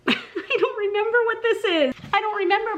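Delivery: high, squeaky giggly confession; high-pitched, sheepish squeak; laughing embarrassment. Real, not acted. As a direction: squeal it helplessly through amusement. Laughs at forgetting the plant's name.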